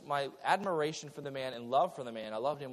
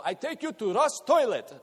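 put-on "Russ Toilet" in a higher, louder Russian-English accent; grandfather's broken English; 7 semitones higher, 7 dB louder than usual. Preacher imitating grandfather's broken English, higher and louder. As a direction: mimic grandpa mangling "Toys R Us" as "Russ Toilet". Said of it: "he'd go".

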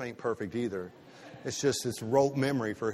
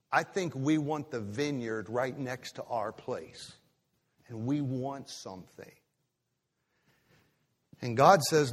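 normal talking voice

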